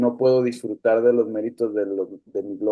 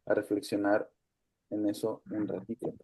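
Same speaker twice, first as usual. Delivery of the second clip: soft invitation; 2 semitones lower, 8 dB quieter than usual. Real, not acted. Invites reflection, fading.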